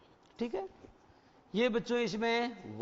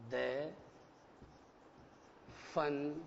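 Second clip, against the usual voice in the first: slow, word by word; 5 semitones lower, 8 dB quieter, much slower than usual. Shown as a demonstration. Teacher to students, naming the chapter title, one word at a time. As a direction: read the title slowly, one word at a time, with long pauses. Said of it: "उसका नाम क्या है?".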